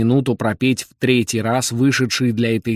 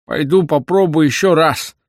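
put-on higher, louder old man's voice; old man character; 5 semitones higher, 5 dB louder than usual. A narrator's reading. Old man heads back indoors, raised character voice.

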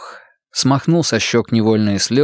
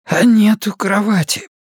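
lightly voiced sick young woman, higher; a hint of a feverish girl; hoarse girl; 10 semitones higher than usual. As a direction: sniffle and rasp it out like a sick girl. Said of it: "шмыгнула носом и просипела".

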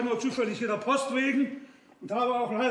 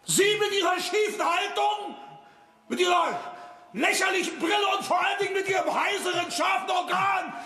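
indignant shout; 8 semitones higher than usual. Acted. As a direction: blast his foolish idea. Direktor empört über Spitter. (empört laut geschimpft)

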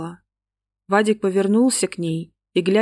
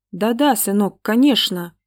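ordinary delivery

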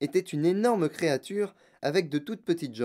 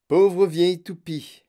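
loud, slow, higher jibe; monsieur Wonka; 5 semitones higher, 9 dB louder, slower than usual. A narrator's reading. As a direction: throw the insult slowly and loudly. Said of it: "dit monsieur Wonka". (insulte lancée fort et lentement)

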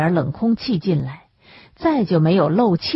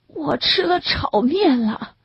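miserable confession; 8 semitones higher than usual. A narrator's reading. Sick man answering weakly.

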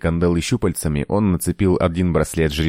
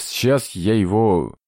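slow, higher-pitched whimper; whiny; 4 semitones higher, slower than usual. A narrator's reading. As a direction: drag it out tearfully. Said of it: "плаксиво завёл Гес". (плаксиво, протяжно)

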